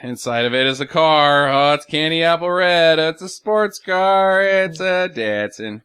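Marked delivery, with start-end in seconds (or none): 1.5-5.8 mock grand announcement. Mock sales pitch of the car.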